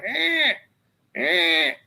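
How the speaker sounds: a thin, high distressed cat cry, repeated; imitated cat cry. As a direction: copy the cat sound up in the tree. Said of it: "and it keeps going".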